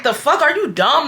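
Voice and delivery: acting out a fed-up outburst; getting mad. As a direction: retell it as an incredulous snap. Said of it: "I start getting mad".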